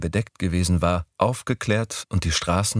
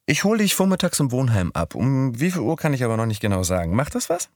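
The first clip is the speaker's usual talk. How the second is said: put-on higher young woman's voice; Midori's voice; 6 semitones higher, faster than usual. A narrator's reading. Narrator voicing Midori proposing Sunday, higher voice. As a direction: pitch up for the girl.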